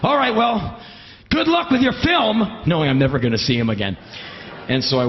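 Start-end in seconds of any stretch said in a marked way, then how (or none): 0.0-2.6 high, drawn-out send-off; over-cheery goodbye. Retold or imitated. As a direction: wish luck with manic cheer. Manic auditioner says cheery goodbye.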